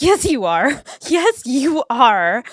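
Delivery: loud, high-pitched hyped-up admiration; over-the-top fangirl affirmation; gushing fan. Acted.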